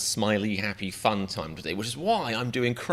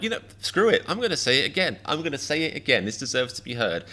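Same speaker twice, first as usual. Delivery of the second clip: defiant joker; 6 semitones higher than usual. Retold or imitated. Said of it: "you're like".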